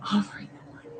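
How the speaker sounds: soft unvoiced whisper; whispered aside to herself. Real, not acted. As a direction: breathe it out as a secret.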